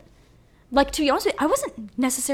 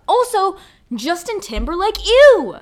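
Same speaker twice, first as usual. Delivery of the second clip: high, loud, grossed-out "ew"; disgusted outburst; 9 semitones higher, 8 dB louder than usual. Real, not acted. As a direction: spit out the name in disgust. Disgust at [name].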